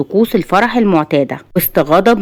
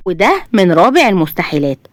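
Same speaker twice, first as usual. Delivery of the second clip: plain reading voice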